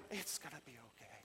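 very soft, breathy near-whisper; gentle reassurance. Acted. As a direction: soothe them in a tiny hushed voice. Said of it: "the one that comes along and, and is like".

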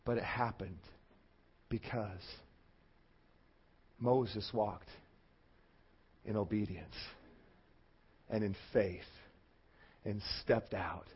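low, very soft, with long pauses; hushed solemn preaching. Real, not acted. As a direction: let each phrase sink in, almost privately. Hushed, halting close of sermon.

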